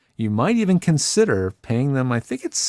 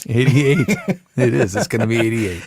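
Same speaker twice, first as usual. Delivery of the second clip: laughing. Real, not acted. Amused echo of episode number.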